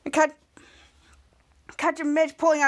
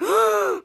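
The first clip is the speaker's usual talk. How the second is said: acted gasp; 5 semitones higher than usual. Startled crowd when the lights go out, acted gasp. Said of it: "everybody gasped".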